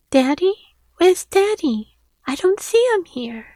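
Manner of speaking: put-on a small child searching for her dad; little girl. Little girl looking for father.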